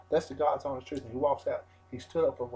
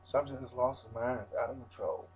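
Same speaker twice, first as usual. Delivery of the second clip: normal talking voice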